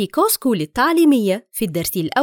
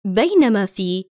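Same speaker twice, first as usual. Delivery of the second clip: ordinary talk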